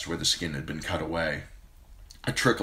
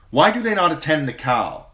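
much higher, louder queen; urgent demand; 10 semitones higher, 9 dB louder than usual. Acted. Queen demands why no one tends the khal, loud high.

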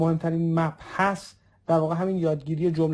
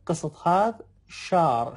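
slow model phrase; much slower than usual. Shown as a demonstration.

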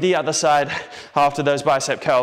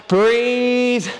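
higher, louder stretched breathe; drawn-out call; 6 semitones higher, 3 dB louder than usual. Real, not acted. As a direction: call it out long and loud. Coach at end of set.

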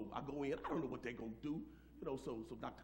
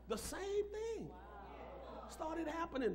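slow preached emphasis; 13 semitones higher, slower than usual. Acted. Pastor building his story to the congregation, slow preached emphasis. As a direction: stretch it out for the congregation.

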